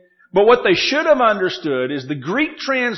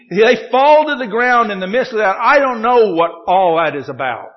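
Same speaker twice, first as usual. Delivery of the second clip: loud, raised pulpit delivery; animated preaching; 6 semitones higher, 6 dB louder than usual. Real, not acted. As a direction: press the puzzle on the congregation. Preaches on the odd event.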